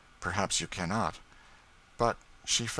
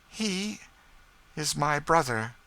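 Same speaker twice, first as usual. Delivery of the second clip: higher, wavering tearful line; a touch of tears; 9 semitones higher, 3 dB louder, slower than usual. A narrator's reading. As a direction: through tears. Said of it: "burst into tears".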